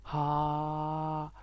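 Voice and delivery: ها held long and breathy with mouth open; long open H sound. Shown as a demonstration. Teacher showing the H sound, long ها. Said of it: "مستمر".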